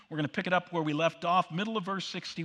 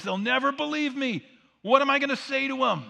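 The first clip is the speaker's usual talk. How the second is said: higher, louder panicky protest; a reluctant Moses pleading his way out; 8 semitones higher, 7 dB louder than usual. Acted. Preacher playing Moses refusing God's job, higher protest.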